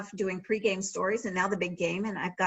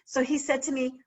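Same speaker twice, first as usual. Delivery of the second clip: ordinary talk, nothing marked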